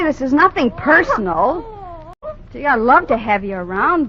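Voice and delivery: ordinary talk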